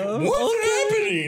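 very high, slow tearful wail; mock crying. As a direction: re-enact bursting into bewildered tears. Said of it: "and I start crying".

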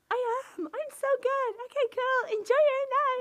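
very high, loud, hammed-up politeness; fake cheery brush-off. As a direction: chirp an awkward goodbye in a squeaky-high put-on voice. Acted over-bright brush-off to ex.

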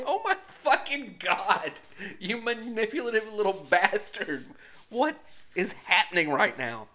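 loud, high, half-laughing exclamation; mock outraged disbelief. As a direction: act out stunned outrage at the child. Retells shock at manipulative child.

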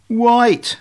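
high, loud, proud answer; duckling proud to know this one. Acted. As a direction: answer like a pleased pupil. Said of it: "said Ugly proudly".

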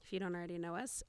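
ordinary delivery

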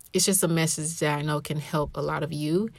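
ordinary talk

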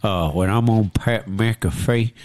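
fake country accent. Podcaster mocking a quarterback's fake country accent. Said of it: "fake country accent".

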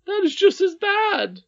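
very high, loud nurse voice; angry retort. Acted. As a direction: fire back shrieking at the child.

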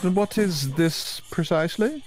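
a lightly put-on genteel adventurer; refined character voice.

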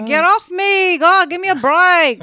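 loud, high, exasperated outburst; exasperated protest. Real, not acted. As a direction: fend someone off noisily. Playfully fending off a partner's advances.

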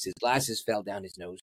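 normal talking voice

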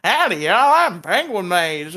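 mock cowboy greeting with thick Southern twang; a cartoon Texan saying howdy; hammed-up southern accent. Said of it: "Who is this southern person".

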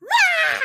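very high mock scream, "rah"; sudden squawk like fighting toddlers; imitated twins' screech. Mom imitating her twins erupting after calm, mock scream. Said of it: "all of a sudden, two seconds later, they're like".

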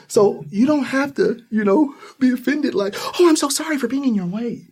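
high, meek over-apologising voice; hammed-up timid sorry; imitating an anxious shopper in a store; mock apologetic shopper. Mimicking over-apology again.

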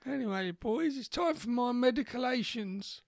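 put-on high, quavering old man voice; lightly voiced grandpa, confused and cranky; doddery old grandad. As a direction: play a confused old war veteran.

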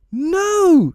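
very high, loud shriek; fairy shriek. A narrator's reading. Fairy stopping Peter drinking poison, loud high shriek. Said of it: "shrieked Tinker Bell".

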